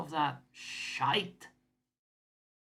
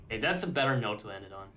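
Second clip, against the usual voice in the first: ordinary talk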